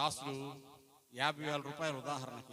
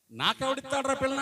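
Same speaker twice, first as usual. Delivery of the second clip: put-on higher, louder scornful echo of the old man; mocking repeat; 8 semitones higher, 10 dB louder than usual. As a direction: throw his words back at him in disbelief. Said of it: "అంటావేంటి?".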